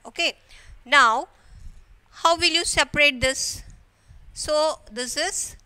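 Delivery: ordinary talk, nothing marked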